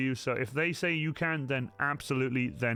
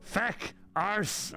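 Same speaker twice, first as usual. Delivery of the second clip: Irish swear words; 7 semitones higher than usual. Shown as a demonstration. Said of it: "Teach me some Irish".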